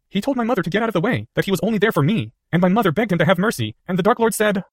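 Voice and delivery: put-on a boy forcing out a terrible memory; young Harry recounting. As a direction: shaken child retelling.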